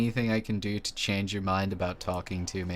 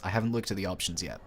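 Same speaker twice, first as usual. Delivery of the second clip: usual speaking voice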